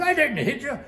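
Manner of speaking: high, loud protest; re-enacted protesting denial. Shipmate denies hitting the bleeding crewman, high loud retold protest. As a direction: throw the accusation straight back, imitated.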